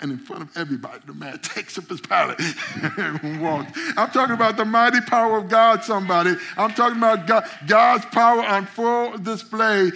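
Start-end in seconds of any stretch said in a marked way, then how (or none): 4.0-9.9 loud, high preaching climax; preaching at full force. Real, not acted. Preacher rousing his congregation, loud and high. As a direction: lift the room with it.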